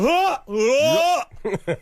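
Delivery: high questioning Jamaican grunts. Shown as a demonstration. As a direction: warm up into a Jamaican accent. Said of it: "What I do for Jamaican is I go".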